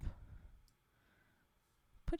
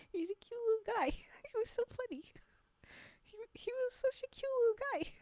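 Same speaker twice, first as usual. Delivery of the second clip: high-pitched adoring coo; gushing; 9 semitones higher, faster than usual. Real, not acted. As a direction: coo over a tiny cute animal. Gushing over the monkey photo.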